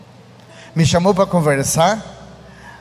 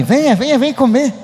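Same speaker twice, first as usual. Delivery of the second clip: retold eager coaxing; 7 semitones higher than usual. Wife coaxing husband to the table.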